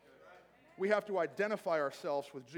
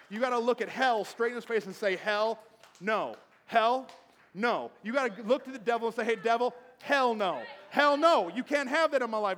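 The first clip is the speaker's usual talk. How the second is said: mad defiance; 3 semitones higher, 7 dB louder than usual. Acted. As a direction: shout the devil down from the pulpit. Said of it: "We've gotta get mad".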